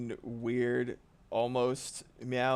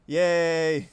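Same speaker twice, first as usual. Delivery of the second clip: loud, raised mock celebration; mock cheer; 5 semitones higher, 10 dB louder than usual. Real, not acted. Streamer celebrating a first dislike, loud mock cheer.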